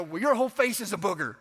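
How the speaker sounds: mock-angry teenage retort; angry comeback. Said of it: "it comes out as anger".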